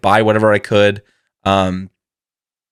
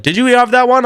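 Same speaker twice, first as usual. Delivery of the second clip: high, louder teasing question; booth guy asking; 14 semitones higher, 4 dB louder than usual. Retold or imitated. Booth guy asks if he tried the sauce, high and teasing. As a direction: squeak out the amused question. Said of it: "the guy behind the booth's like".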